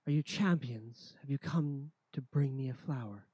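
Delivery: loud rasping lady voice; raspy voice. Acted. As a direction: rasp it out loudly. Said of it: "you hear a raspy voice say".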